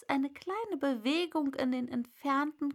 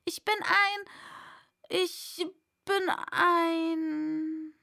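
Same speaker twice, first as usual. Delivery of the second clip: halting, slowing, unsure; faltering; 6 semitones higher, 4 dB louder, much slower than usual. A narrator's reading. Alice faltering before the pigeon, halting. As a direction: stall for the words.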